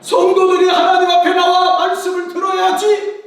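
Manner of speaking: high, loud preacher's cry; preaching shout. Real, not acted. Pastor crying out to the congregation about hearing the word, loudly. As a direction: belt it out from the pulpit.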